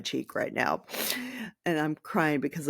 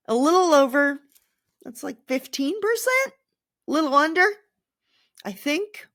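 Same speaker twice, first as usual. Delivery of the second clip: unsure, rising; 8 semitones higher, 4 dB louder than usual. Real, not acted. Unsure math out loud.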